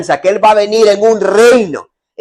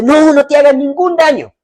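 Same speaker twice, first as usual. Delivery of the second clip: urgent call; 9 semitones higher than usual. Acted. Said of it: "Pablo le grita y le dice".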